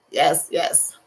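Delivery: ordinary delivery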